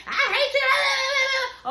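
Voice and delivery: very high, drawn-out screamed imitation; mock shout. Mimicking British screaming at Lorenzo before he hangs up, drawn-out shout. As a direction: stretch it into a shrill scream. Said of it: "he listened to whatever she".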